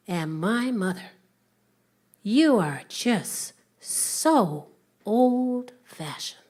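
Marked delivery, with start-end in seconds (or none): 2.2-6.4 attitude. Acted. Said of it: "Jack with the attitude".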